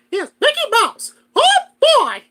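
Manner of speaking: high, loud squeaky cartoon mouse; Mickey Mouse voice. Shown as a demonstration. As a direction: pop into a high Mickey falsetto. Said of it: "character I know for sure I could spot on do".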